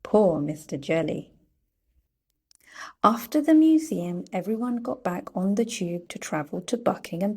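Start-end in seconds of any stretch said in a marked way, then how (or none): none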